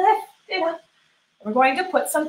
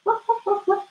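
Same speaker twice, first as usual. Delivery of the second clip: high playful woofs; cheerful high puppy barks; dog barking; 9 semitones higher than usual. Shown as a demonstration. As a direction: bark like a puppy for the kids. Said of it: "And we can say".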